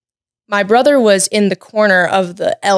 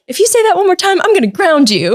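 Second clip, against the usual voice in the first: high, louder mock-stern parent threat; stern dad; 11 semitones higher, 3 dB louder, faster than usual. Dad to his panicking daughter, mock-stern threat. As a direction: bark it like an exasperated dad, hammed up.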